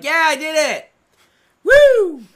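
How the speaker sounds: high, loud mock victory cheer; triumphant whoop. Wrestler celebrating a win he didn't get, loud mock whoop. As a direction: crow like a wrestler who thinks he's won. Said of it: "Rusev gets up like".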